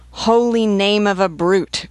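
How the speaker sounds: loud, high, drawn-out exclamation; a soldier's outraged cry, stretched out. A narrator's reading. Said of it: "cried a grenadier".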